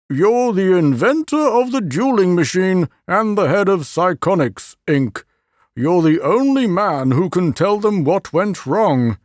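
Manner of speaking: a hint of a somber official; university president voiced. The president describing Dulac, a touch grave.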